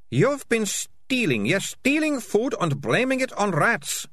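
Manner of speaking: put-on high, slow, sharp accusing girl; snapped accusation. A narrator's reading. Malicia accusing the rat catchers of stealing, high sharp snap. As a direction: jab each word at the thugs, slowly. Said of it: "snapped Malicia".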